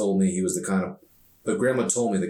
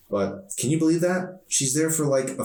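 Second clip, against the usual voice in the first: put-on higher, exasperated girl's voice; indignant teen girl; 8 semitones higher than usual. Emery complains to Arson about her mom, exasperated and higher.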